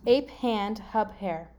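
very slow, separate words; deliberate word-by-word puzzle clue; gibberish readout. Shown as a demonstration. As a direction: space out ape, hand, hub, hair evenly. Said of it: "read you what it says".

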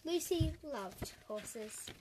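put-on slow read to children; storytelling. A narrator's reading. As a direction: read it gently and slowly to kids. Story narration.

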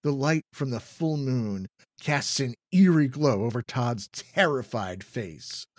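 ordinary delivery

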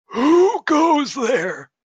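put-on high, loud, slow old man call. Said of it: "an old man ... calls out".

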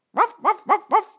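high, loud yapping barks; yapping little dogs. A narrator's reading. Little dogs yapping at the shoe shop assistant, shrill yapping. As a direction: bark like two small yappy dogs. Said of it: "de hondjes die keften weer".

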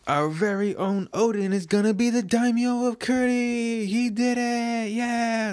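high, thrilled squeal of joy; overjoyed cheer. Acted. Villager cheering that their Odin is now Daimyo, very high and elated. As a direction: crow it like the best news ever. Fans cheer Odin's new title.

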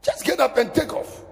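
high, loud inner command to leave; urgent command. Pretend. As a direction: bark it like a sudden warning. Voicing urgent inner prompting.

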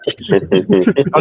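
ordinary talk, nothing marked

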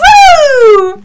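loud, high cheer; excited whoop. Real, not acted. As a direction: whoop it up with glee. Cheering at the sex joke.

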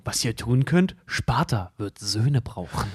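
epic movie quote. Acted. Movie quote of Spartan warrior speech.

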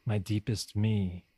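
ordinary talk

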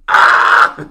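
abrupt, very loud mock scream; imitated scream. Imitating the guy's cat sound effect, blasting scream. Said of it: "imitates sound effects".

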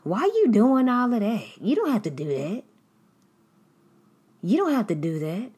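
loud, fast, scolding; mock yell. Nosy churchgoer scolds a giver, mock yell. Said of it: "yell at you".

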